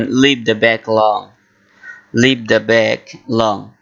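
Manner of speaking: very slow, careful English; slow model phrase. Shown as a demonstration. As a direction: say it slowly for learners. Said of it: "ইংলিশে বলবেন". (ধীরে ধীরে স্পষ্ট উচ্চারণে)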